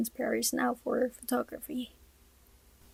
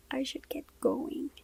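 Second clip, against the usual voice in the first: soft breathy whisper, barely voiced; hushed late-night whisper; 3 semitones higher, 6 dB quieter than usual. A narrator's reading. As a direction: whisper it so no one else wakes. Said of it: "I whisper".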